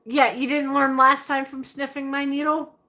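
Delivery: ordinary talk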